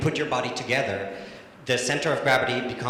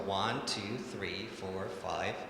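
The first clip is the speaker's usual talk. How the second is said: slow soft count; 4 semitones lower, 7 dB quieter, much slower than usual. Shown as a demonstration. Coach counting the dancer through the hold, soft and low. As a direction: lower and softer counting. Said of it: "You can even wait longer".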